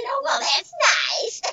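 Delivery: high, soft mock-polite reply; feigned politeness. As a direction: put on a strained, high-pitched niceness. Acting her awkward reply to fan.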